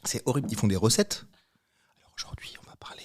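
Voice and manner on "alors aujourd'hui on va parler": soft mock ASMR whisper, intro voice. Shown as a demonstration. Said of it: "parlent comme ça".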